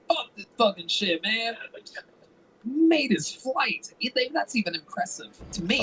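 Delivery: high-pitched mock outrage, words stretched; imagined indignant listener. Host imagines a listener hearing the flight story, mock indignant.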